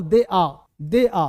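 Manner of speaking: slow, loud "दे आ" without r; correct DE AA. Shown as a demonstration. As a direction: draw out both words of "दे आ", no r. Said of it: "आर को आ नहीं बोल". (धीरे, ज़ोर से "दे आ", र छोड़कर)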